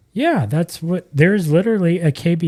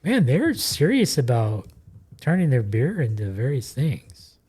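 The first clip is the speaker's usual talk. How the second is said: ordinary talk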